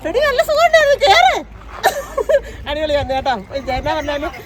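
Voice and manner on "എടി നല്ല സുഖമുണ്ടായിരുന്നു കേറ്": high, loud excited call. Real, not acted. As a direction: call her over, thrilled and loud. Calling out to friend excitedly. (ആവേശത്തോടെ വിളിച്ചു പറയുന്നു)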